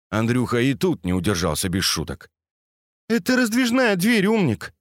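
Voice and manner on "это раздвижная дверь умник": much higher voice with a smile; smiling teasing. A narrator's reading. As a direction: tease him through a smile, pitched high. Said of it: "улыбнулась Катя". (с улыбкой, поддразнивая, высоким голосом)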